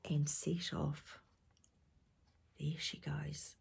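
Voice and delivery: hushed storyteller whisper. A narrator's reading. Storyteller to children, whispering. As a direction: breathe it to the little listeners.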